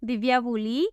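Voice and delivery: usual speaking voice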